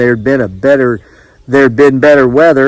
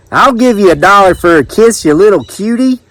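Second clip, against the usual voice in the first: raised-pitch country flirt; flirting customer; 7 semitones higher than usual. A narrator's reading. Jethro offering money to the kiss seller, high flirty voice. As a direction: make the offer eagerly, pitched up.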